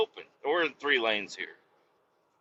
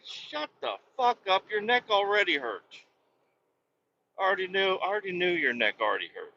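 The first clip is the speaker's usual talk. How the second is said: higher-pitched put-on voice, brushing them off; mock retort; 5 semitones higher than usual. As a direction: snap back at the fake injury claim. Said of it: "It's like".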